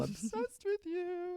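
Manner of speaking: squeaky, very high and slow; high squeal. Acted. Repeated line, plain then squeal.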